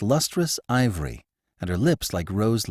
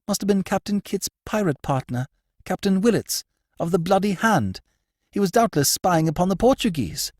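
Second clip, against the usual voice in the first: high, urgent noblewoman; excited high lady's voice; agitated lady; 12 semitones higher than usual. Acted. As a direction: press him for news, voice high.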